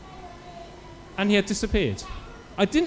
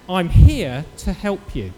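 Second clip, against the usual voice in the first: slow, louder and commanding; authoritative; 8 dB louder, much slower than usual. Retold or imitated. Stranger in the shop insisting on helping the preacher, slow and authoritative. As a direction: lay down the law, slowly and loudly. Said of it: "he said it with such authority in his voice".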